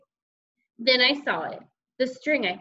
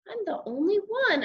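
sniffing; 11 semitones higher than usual. A narrator's reading.

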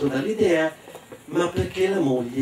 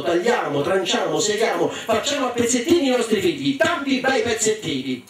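gongolante, sale sempre più acuta verso la fine; madre perfida; 5 semitones higher than usual. Acted. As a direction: madre cattiva che si esalta del proprio piano. (madre perfida che gongola)

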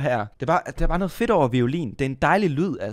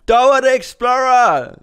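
excited shout; 11 semitones higher, 9 dB louder, slower than usual. Real, not acted. Thrilled at the Dora option.

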